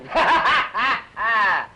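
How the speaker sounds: scornful burst of laughter; mocking laugh. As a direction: laugh her words off. Mocks her denial.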